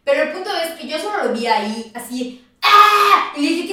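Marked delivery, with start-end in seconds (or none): 2.6-3.3 loud, high yelp; short mock scream of pain; imitated scream. Imitates the injured boy screaming. (grito corto imitado)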